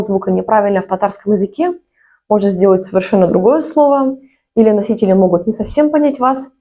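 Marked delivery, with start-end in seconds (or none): none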